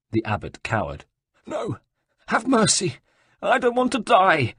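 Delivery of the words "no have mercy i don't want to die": high, frantic plea for mercy; burbling plea. Acted. Cowering abbot begs Ezio for mercy, high and frantic. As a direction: babble for your life. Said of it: "he burbled".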